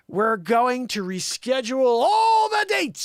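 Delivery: slow, high mock-dramatic proclamation; dramatic declaration. Host quoting [name]'s promise, slow dramatic cry. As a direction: stretch it out into a big vow.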